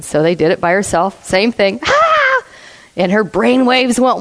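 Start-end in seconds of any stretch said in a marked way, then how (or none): 1.9-2.4 imitated scream. Woman shocked alone again.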